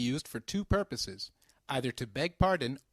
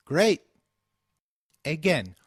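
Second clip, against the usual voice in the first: ordinary talk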